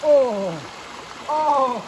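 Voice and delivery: cold-shocked gasping; gasping in icy wind. Real, not acted. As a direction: gasp through the chill. Entering icy water.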